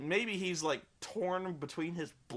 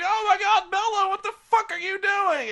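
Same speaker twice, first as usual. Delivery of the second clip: much higher, louder panicked shout; alarmed yell; 14 semitones higher, 7 dB louder than usual. Retold or imitated. Jacob seeing Bella crash, high loud alarmed. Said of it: "he's like".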